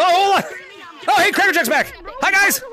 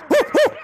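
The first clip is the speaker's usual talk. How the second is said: two high, short whoops; excited whoop; 9 semitones higher than usual. Real, not acted. Streamer fleeing in shark attack, whooping. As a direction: whoop while racing along.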